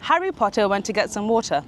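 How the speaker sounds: British accent model sentence again at full pace. Shown as a demonstration. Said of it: "Try this".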